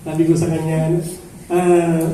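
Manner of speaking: ordinary delivery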